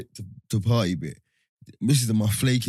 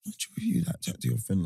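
soft, hushed imitation of a girl; small mock-girl voice; imitated girl; 2 semitones higher, 7 dB quieter, faster than usual. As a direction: quietly mimic a girl asking a favour. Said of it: "You know when they was like".

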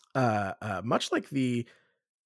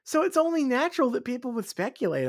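mock reasonable; 10 semitones higher, faster than usual. Mock-reasonable high fast line.

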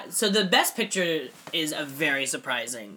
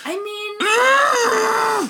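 sudden high hammed-up yell; mock shout; 6 dB louder than usual. Mocking Leo screaming at the child, hammed-up high shout. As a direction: burst out in an over-the-top mock scream.

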